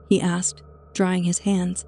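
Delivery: ordinary delivery, nothing marked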